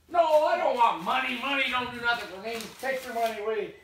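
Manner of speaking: high, loud complaint at being disturbed; exasperated protest. Pretend. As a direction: wail it like someone woken from a nap. Refusing money while trying to nap.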